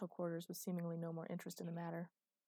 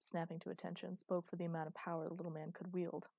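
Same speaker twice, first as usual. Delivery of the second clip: plain reading voice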